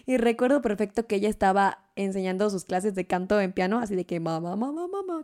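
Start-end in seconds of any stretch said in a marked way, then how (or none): 4.2-5.2 imitating a small child calling out, last one high and soft; little child calling mom. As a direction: call out like a little kid wanting mom. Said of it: "con un niño chiquito". (niño pequeño llamando a su mamá, la última más aguda y suave)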